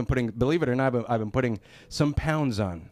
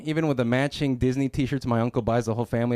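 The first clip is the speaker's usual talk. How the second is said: normal talking voice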